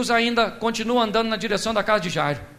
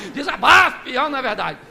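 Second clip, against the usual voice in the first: normal talking voice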